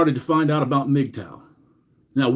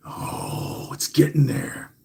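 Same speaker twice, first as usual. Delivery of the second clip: hushed build-up; 2 semitones lower, 5 dB quieter, slower than usual. Acted. Building up a reveal on stream.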